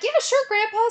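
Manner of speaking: high, sing-song sure grandpa; placating. Retold or imitated. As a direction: humor the old man.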